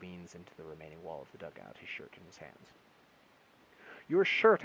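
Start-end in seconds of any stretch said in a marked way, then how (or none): 4.1-4.6 very loud, very high yell; shout. A narrator's reading. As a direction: scream it across the caving dugout. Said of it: "I shout".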